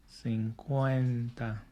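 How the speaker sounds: very slow cuarenta, every syllable drawn out; slow model word. Shown as a demonstration. Teacher drills cuarenta for students, very slowly. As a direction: stretch cuarenta out for learners to copy. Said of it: "Say it with me".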